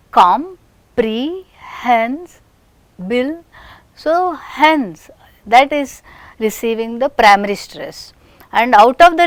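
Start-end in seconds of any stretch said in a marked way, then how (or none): 0.1-3.4 "comprehensible" very slowly, pause between syllables. Shown as a demonstration. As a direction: stretch out each syllable of "comprehensible". Teacher breaks word into syllables.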